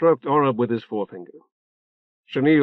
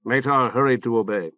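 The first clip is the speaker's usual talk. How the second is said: plain reading voice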